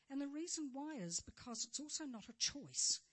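usual speaking voice

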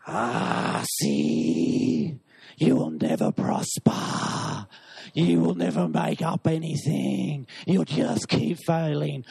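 low, hissing demon taunts; taunting demon. Acted. Demon whispers to the man that he'll never prosper, low and taunting. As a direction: whisper the lie like a sly little demon.

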